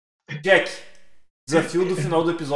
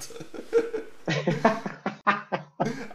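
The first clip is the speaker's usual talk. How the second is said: breathy amused chuckle; 5 semitones higher than usual. Real, not acted. Riso depois da piada. (risada soprada)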